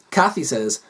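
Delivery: ordinary delivery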